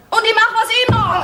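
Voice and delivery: high defiant shout; high-pitched, headstrong outburst; wild defiance. Acted. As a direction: shout it in open rebellion. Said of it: "da geht's ja wild auf".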